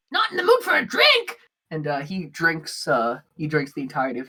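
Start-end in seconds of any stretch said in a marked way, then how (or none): 0.1-1.4 high-pitched little creature voice; squeaky disbelief at a refused drink; incredulous Bug. Acted. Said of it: "Bug looks at Brock and just, like".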